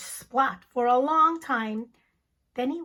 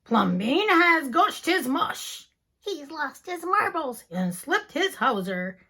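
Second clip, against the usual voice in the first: scandalized neighbors; 3 semitones higher, 5 dB louder than usual. Acted. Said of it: "the people said".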